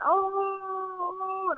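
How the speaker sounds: long held "oh", very high; acted-out falsetto howl of pain; high-pitched wail. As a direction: sustain a high keening cry. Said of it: "at the highest pitched sound".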